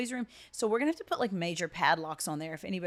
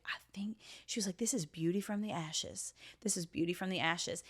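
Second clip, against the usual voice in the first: hushed whisper, much softer; whispered quote; 6 dB quieter than usual. Retold or imitated. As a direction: breathe the words like a secret.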